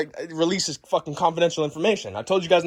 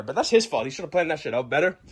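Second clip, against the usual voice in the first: plain reading voice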